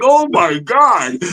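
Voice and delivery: high, drawn-out disbelief; hammed-up, slow awestruck exclamation; amazed reaction. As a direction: stretch it out high in giddy amazement. Acts out stunned reaction, laughing.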